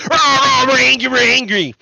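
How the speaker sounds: high mock tantrum of the Fox audience; mock angry audience. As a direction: play the riled-up crowd, hammed up. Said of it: "the audience of Fox is like".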